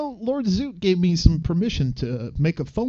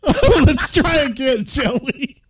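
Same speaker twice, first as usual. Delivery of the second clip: laughing; 8 semitones higher, 6 dB louder than usual. Real, not acted. Laughing after the call hangs up.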